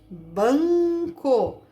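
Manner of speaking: BANCO with a long nasal first syllable; som nasal demonstrado. Shown as a demonstration. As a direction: sound out "banco" slowly, holding the nasal. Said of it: "Por N". ("banco" com a primeira sílaba nasal esticada)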